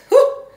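loud, high-pitched nervous whew. Real, not acted. Vlogger bracing herself to apply the mask, high whew. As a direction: blow out a squeaky whew.

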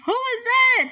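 sudden high startled question; surprised. Real, not acted. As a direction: caught off guard, who is that. Startled by a character on screen.

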